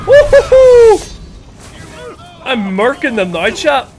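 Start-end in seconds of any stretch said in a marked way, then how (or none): 0.1-1.0 high loud victory whoop; excited whoop. Real, not acted. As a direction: whoop it up triumphantly. Cheering after a kill.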